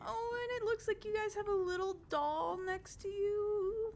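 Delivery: high, bubbly noble lady; cheerful Adela. Acted. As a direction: chirp it up like an eager hostess. Adela notices doll.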